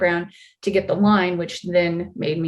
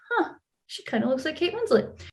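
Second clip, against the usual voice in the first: higher and softer, puzzled; quiet musing; 7 semitones higher, 6 dB quieter than usual. Retold or imitated. Extra spotting someone down the hallway, hushed puzzlement. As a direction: wonder it half to yourself. Retelling a private, puzzled realisation.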